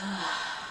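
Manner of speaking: soft open-mouthed sigh letting the breath go; deep release sigh. Shown as a demonstration. Teacher showing the exhale for viewers, soft sigh. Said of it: "release it through our mouth in a sigh".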